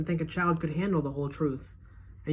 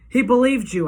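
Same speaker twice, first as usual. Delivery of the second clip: higher, louder accusing cry; angry accusation; 8 semitones higher, 10 dB louder than usual. A narrator's reading. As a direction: hurl it at the doctor. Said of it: "I say".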